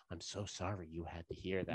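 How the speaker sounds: imitated gentle, hushed apology. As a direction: say it low, as if to children. Old woman retold apologising to kids.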